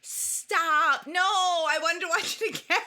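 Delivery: loud and high "stop", laughing in protest; real dismayed surprise. Real, not acted. Hears the movie leaves today.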